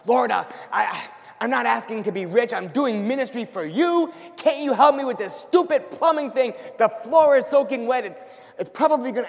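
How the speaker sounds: a mock-exasperated gripe to God; whining to God. Preacher retelling his complaint to God, loud whining gripe. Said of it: "I started whining and whining".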